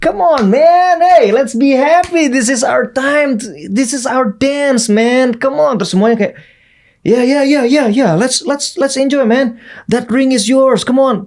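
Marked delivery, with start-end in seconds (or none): 0.0-5.8 much higher and louder, stretched hype; hyped-up pep talk. Retold or imitated. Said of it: "yang bikin mereka happy". 7.0-11.2 higher, eager chorus of agreement; excited team reply. Retold or imitated. Team echoing his hype.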